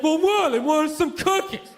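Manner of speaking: loud, slow dopey oaf; biggest moron. Retold or imitated. Boyfriend defending himself on the talk show, loud moronic voice. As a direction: play a dim guy blurting his excuse. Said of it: "always the biggest moron ever".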